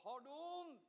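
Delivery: imitating the wife, faint and high; very soft, far-off voice; distant relayed question. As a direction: ask it as if turned away from the phone. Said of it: "Hon frågade".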